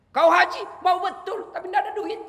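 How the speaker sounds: high, loud playful teasing; teasing angel. Acted. Angel teases the maid about hajj, loud and playful. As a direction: tease her fondly.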